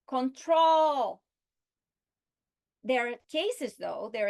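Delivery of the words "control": TROL in "control" high, very loud and long; stress on second syllable. Shown as a demonstration. Teacher showing verb stress, TROL stressed in "control". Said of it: "stress on the second syllable".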